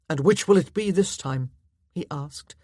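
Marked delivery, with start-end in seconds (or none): 0.1-1.5 louder, mean jibe; nasty, mean. A narrator's reading. Husband asking celebrate or commiserate, nasty. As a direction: needle her nastily. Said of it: "Nasty. Worse than petty now. Mean".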